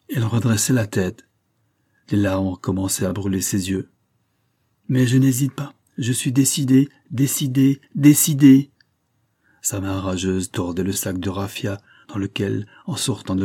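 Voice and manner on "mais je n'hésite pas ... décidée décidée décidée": rising, ending much louder on the last "décidée"; each "décidée" harder than the last; building resolve. Acted. Rage of a decided woman.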